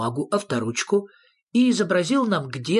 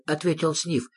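plain reading voice

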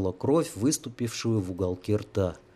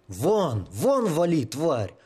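breathless shout; 4 semitones higher, much slower than usual. Acted. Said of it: "тяжело дыша заорал Сергей".

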